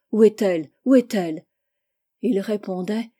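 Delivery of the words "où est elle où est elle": cherche-la en criant à ses soldats; voix d'officier qui appelle fort, inquiet. A narrator's reading. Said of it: "un officier qui disait à ses soldats". (un officier crie à ses soldats, appel fort et pressant)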